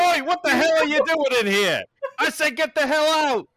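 high, loud British yell; furious British bartender. Acted. Bartender as the kisser walks back in, furious British shout. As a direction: bellow them out in a British accent.

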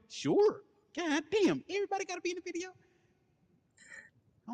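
very high, fast comic squeal; high mock outrage; squeaky high voice. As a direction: squeak it out in disbelief.

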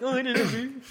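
high-pitched, casual character voice; Korg. Retold or imitated. Host retelling the rock monster's reply to co-hosts, high mock voice. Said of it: "Korg's like".

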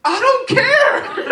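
hammed-up careless student with a laugh; mock dismissive teen. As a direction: sneer it like a bored kid. Students' dismissive reply to pastor.

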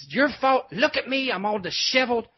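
high, mock-outraged rant; imitating a furious telling-off; retold angry screaming. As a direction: raise the pitch like an indignant star. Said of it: "he's screaming at me in front".

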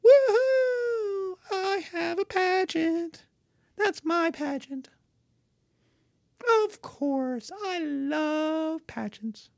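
high squealing glee, drawn out; a vain patron thrilled his name is on it; gleeful rich guy. Acted. Billy gloats over his pageant.

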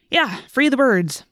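sarcastic. A narrator's reading. Marco mocking Tobias's bird freedom talk, slow and sarcastic. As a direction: drag it out as if the idea is silly. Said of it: "Marco commented sarcastically".

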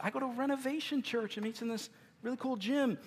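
high-pitched imagined churchgoer; mock member's answer. Member answering where they go to church, high-pitched mock reply.

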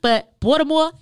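Baltimore said loud and high with no T, "Balmore"; laughing Baltimore accent demo. Shown as a demonstration. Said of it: "They don't pronounce half they syllables".

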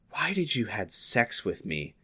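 soft, wounded question; hurt, gentle. A narrator's reading. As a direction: ask it quietly, with gentle hurt. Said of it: "with a hurtful tone and a gentle voice".

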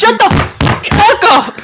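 loud, slow, high shout of disbelief; excited shout. Real, not acted. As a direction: yell it out in playful disbelief. Reacts to basketball jersey answer.

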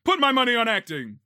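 loud, high mock declaration; playful shout. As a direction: belt it out like a hammed-up contestant. Mock game-show guess, shouted.

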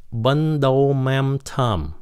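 bún đậu mắm tôm in a slow American accent. Shown as a demonstration. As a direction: say the Vietnamese dish name like an American, toneless vowels. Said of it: "Người Mỹ phát âm cái chữ bún đậu mắm tôm vậy đó". (giọng người Mỹ đọc "bún đậu mắm tôm")

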